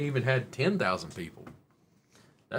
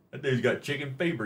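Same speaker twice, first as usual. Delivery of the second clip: ordinary talk, nothing marked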